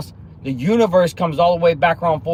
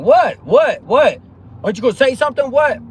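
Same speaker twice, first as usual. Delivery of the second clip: loud, high, pushy repeated whats; mock badgering shout; 9 semitones higher, 6 dB louder than usual. The other person yells at him to say something, in a loud, high mock shout. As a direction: demand an answer over and over, much higher and louder.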